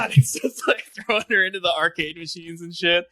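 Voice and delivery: ordinary delivery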